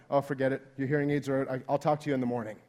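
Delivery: low, giving-up mumble to a spouse; weary dismissal. Retold or imitated. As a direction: give up and wave it away. Husband gives up talking in bed.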